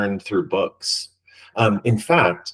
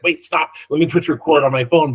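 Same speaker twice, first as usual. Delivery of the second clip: higher, louder, hurried wait, stop; retold quick excitement, a bit faster; urgent interruption; 6 semitones higher, 4 dB louder than usual. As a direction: cut her off eagerly to start recording. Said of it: "And I was like".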